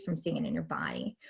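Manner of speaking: sudden low whisper to close. Shown as a demonstration. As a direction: drop to a breathy whisper. Whispered demonstration of ending.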